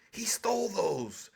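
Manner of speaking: breathy stage whisper of a secret. Real, not acted. Whispering to Truck that he stole those secrets. Host confiding to Truck about Trump.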